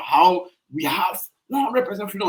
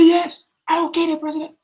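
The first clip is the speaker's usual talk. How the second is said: defiant threat; 11 semitones higher, 4 dB louder than usual. Retold or imitated. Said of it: "He said".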